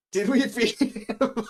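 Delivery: high, loud, slow through laughter; real helpless laughing delivery; laughing question. Real, not acted. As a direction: crack up while asking. Laughs at own parenting.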